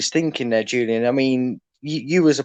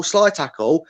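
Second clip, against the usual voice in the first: normal talking voice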